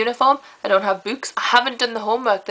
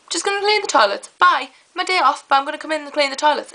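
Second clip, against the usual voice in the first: higher, sing-song mock of the coworker; 6 semitones higher than usual. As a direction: mimic her smug excuses, pitched up and quick at the end. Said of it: "You're just like".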